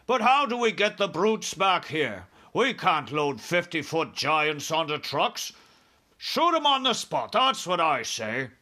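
high, loud military objection; army chief, impatient. Acted. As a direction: argue as an exasperated army chief.

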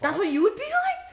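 can't believe what she hears; disbelief. Real, not acted. Shocked at friend's reaction.

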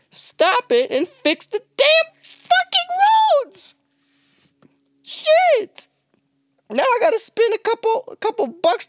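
screaming in real frustration, pitch shooting up high; a real angry outburst at the roads; furious shout. Real, not acted. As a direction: yell it at the city, fed up and furious. Furious rant about potholes.

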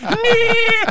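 mock robot pony whinny. The Christmas robot pony neighs in a silly squeal.

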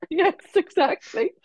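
high, slow, laughing agreement; laughing yes. Real, not acted. Guest agreeing with the host's joke, laughing. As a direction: stretch it out through the laugh.

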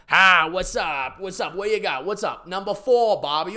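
high-pitched caricature of a Boston guy; hammed-up Boston accent greetings; mock Boston accent. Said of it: "dating a guy from Boston".

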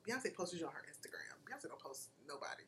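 hushed, breathless whisper. Real, not acted. Friend whispering in awe about a [name] repost.

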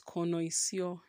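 connoisseur drawn out as ko-noy-see-OR; wrong pronunciation of connoisseur. Shown as a demonstration. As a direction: say connoisseur slowly as spelt, with "noy". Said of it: "Not connoisseur".

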